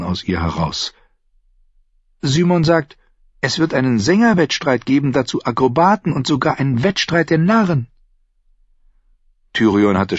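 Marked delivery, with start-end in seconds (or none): 2.2-7.9 junge Frau, höher gesprochen, neugierig; Shay. Acted. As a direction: fragt ihn leise drängend aus. Shay schwärmt. (Shay, junge Frau, höhere Stimme)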